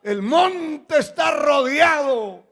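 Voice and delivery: high, loud cry of alarm; frightened servant. Retold or imitated. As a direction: act out the servant's panic, dragging the words. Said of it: "estaba temblando, asustado, salió en la mañana y dijo".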